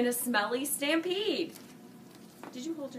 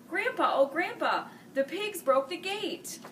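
put-on urgent kid calling out; calling child; 7 semitones higher than usual. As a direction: shout the news up to Grandpa. Grandkids calling out to Grandpa.

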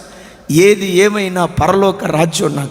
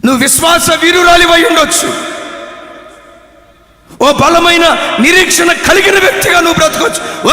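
louder, driving sermon cadence; loud exhortation; 7 semitones higher, 5 dB louder than usual. Acted. Preacher exhorting a faithful woman, loud and driving. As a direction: drive each clause hard at the listener.

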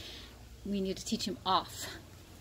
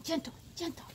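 soothing coax; 8 semitones higher, 10 dB quieter than usual. Real, not acted. Soothing the dogs.

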